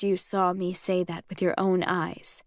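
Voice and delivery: plain reading voice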